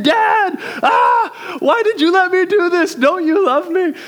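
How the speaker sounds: put-on very high, loud yelping cry of a burned kid; shrill howl of blame; pained child's wail. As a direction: wail at dad like a hurt little boy. Child burns hand, cries out.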